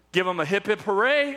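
normal talking voice

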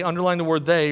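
normal talking voice

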